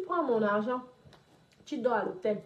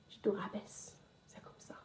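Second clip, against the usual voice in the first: drops to a breathy threatening whisper; menacing whisper; 2 semitones lower, 11 dB quieter than usual. Real, not acted. To the debtor who fled with her money, menacing whisper. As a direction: whisper the threat right in his ear.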